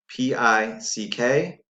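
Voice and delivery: P I C K spelled out letter by letter, slowly; spelling aloud slowly. Shown as a demonstration. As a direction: spell each letter as you write. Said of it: "Say it as you write it".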